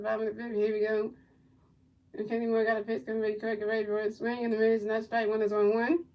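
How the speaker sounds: low, quiet, steady game call; soft running commentary; play-by-play call. Acted. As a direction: murmur the pitches like a broadcaster.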